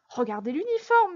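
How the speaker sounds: high, urgent appeal; insistent cry for attention; exclaiming. A narrator's reading. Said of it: "s'écria à son tour".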